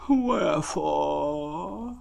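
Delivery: very slow, high, drawn-out mournful donkey; gloomy Eeyore. Acted. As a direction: drag it out like a sad old donkey.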